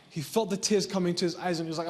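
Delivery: usual speaking voice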